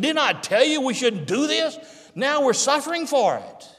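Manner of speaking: high-pitched, worked-up complaint; exasperated reproach. Acted. Reuben scolds his brothers over their guilt, exasperated reproach. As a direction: push the voice up in protest.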